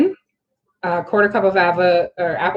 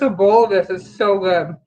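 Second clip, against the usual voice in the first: mouth full. Real, not acted. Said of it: "sorry, my mouth is full".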